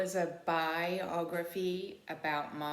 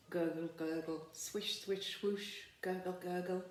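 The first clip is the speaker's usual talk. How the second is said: river sounds. Acted. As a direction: murmur it like a lazy river.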